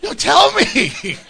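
loud, high, drawn-out begging; mock desperate pleading. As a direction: beg for it in exaggerated frustration. Acting a listener begging for secret.

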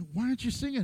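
hushed, pointed question from the neighbour; a soft nudge under the music; mock quiet question.

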